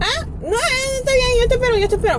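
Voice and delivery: high, whiny mock protest; imitating Papi's insistence. Papi insisting he will wait, whiny.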